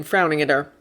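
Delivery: usual speaking voice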